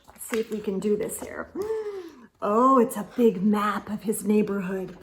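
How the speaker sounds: usual speaking voice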